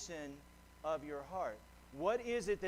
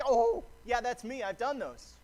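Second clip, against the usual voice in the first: excited; 3 semitones higher, 7 dB louder than usual. Retold or imitated. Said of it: "the guy's excited".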